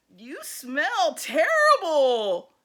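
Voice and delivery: loud, slow, disgusted jab; rude girl. Acted. Leslie greets Sammy with a loud, slow sneer. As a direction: sneer it out at the new boy. Leslie insults the new boy.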